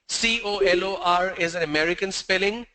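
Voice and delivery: usual speaking voice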